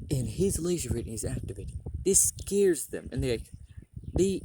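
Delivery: ordinary talk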